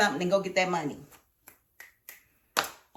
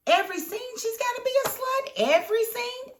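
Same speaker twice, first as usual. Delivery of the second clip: "Every scene" squeaked much higher; exasperated. Real, not acted. Complaining about the show's writing, high disbelieving "Every scene".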